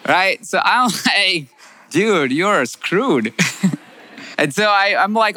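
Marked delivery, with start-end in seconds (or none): 1.9-3.3 a slow, rueful warning to himself; slow self-warning. Retold or imitated. Intern warns himself he is doomed, slow and rueful. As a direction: draw out each word slowly, half laughing.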